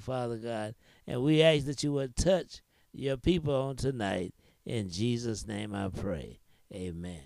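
low, earnest prayer voice. Acted. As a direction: pray over the word, low and steady. Opening prayer.

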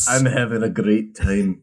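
loosely put-on Scottish accent; attempted Scottish accent. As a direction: have a playful go at a Scottish accent. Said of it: "say Scottish in a Scottish accent".

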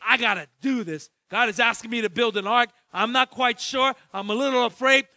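normal talking voice

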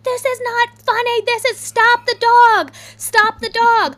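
high, loud panicked yelling at the family; frantic yelling. Retold or imitated. Mom yelling at her laughing daughter, loud and frantic. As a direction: yell it, desperate for someone to stop the dog. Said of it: "and I'm like".

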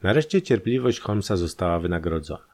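usual speaking voice

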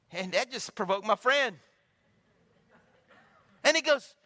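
high, loud preacher's drive; full-force sermon delivery; fervent preaching. Real, not acted. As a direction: preach it with fire to the crowd.

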